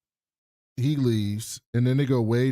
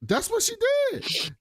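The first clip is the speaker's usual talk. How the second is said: very high, laughing protest; laughing insistence. Real, not acted. Teller defends the retelling to laughing co-host, laughing. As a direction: insist through real laughter, voice shooting up.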